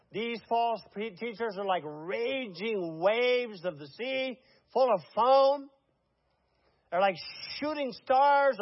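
fiery preacher listing the sins; preaching. Real, not acted. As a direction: build the sermon list with rising force. Preaching on false teachers.

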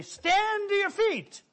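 high, drawn-out preacher's call; bold preacher's command. Retold or imitated. Preacher challenging the congregation to stand, a high commanding call.

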